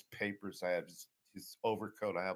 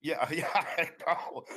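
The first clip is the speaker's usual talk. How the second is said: slow, higher, laughing through the words; real laughter; 8 semitones higher, 5 dB louder, slower than usual. Real, not acted. Laughing along with the interviewer's joke about Irish history, amused.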